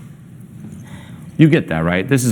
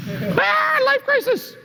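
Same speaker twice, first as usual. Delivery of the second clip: mock child wail; 5 dB quieter, much slower than usual. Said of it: "kid wail again for adult".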